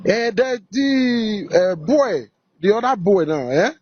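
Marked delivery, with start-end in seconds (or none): none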